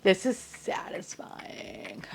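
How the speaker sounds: slow, soft whisper; hushed satisfaction. Real, not acted. Collector admiring her lined-up toys, hushed satisfaction. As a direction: savour it slowly under your breath.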